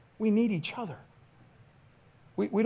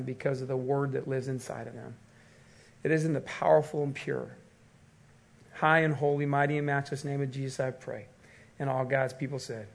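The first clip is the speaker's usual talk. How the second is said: measured, lowered prayer voice; public prayer; 4 semitones lower than usual. Real, not acted. Pastor asks God to be with his church, prayerful. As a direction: lead the congregation in earnest, subdued prayer.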